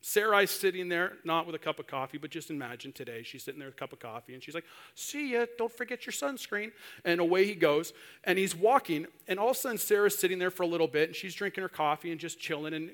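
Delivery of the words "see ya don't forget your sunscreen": high, breezy goodbye; lightly sung-out wifely goodbye; breezy send-off. Acted. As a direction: toss off a carefree, higher-pitched farewell. Wife's carefree send-off to husband.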